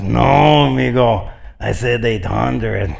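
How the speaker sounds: the greedy cop leaning on the pastor, unhurried; mock cop demand.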